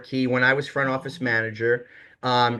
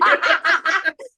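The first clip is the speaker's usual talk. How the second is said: high laugh; 4 dB louder than usual. Real, not acted. Laughing at his own boss story, a burst of high laughter.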